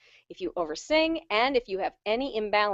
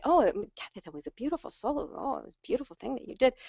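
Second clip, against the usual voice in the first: higher, softer gushing praise; imitated compliment; 4 semitones higher, 5 dB quieter than usual. Churchgoer praising a singer's solo, imitated gushing. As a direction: gush softly in mock admiration. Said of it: "When people say".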